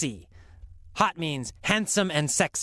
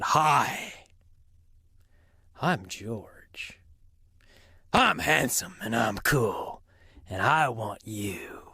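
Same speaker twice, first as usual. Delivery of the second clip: lightly voiced cool suitor; 6 semitones lower, slower than usual. Storyteller voices smooth suitor George.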